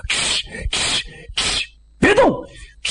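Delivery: hissing air sound. Retold or imitated. Air leaking from tire.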